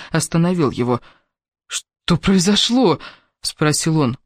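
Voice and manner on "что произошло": slow, higher, drawn-out question; puzzled and slow, voice lifted; bewildered question. A narrator's reading. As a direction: stretch it out in bewilderment. Baffled man questions angry lover.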